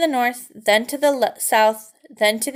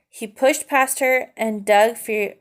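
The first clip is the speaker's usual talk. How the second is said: usual speaking voice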